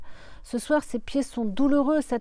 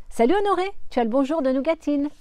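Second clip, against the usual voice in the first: high, loud, quick call upward; 5 semitones higher, 5 dB louder, faster than usual. Acted. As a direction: shout a cheeky greeting upstairs. Calling up to statue. (appel joyeux, aigu et fort)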